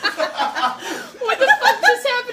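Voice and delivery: slow, high, laughing disbelief. Real, not acted. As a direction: laugh through the words. Reacting to a spit-take.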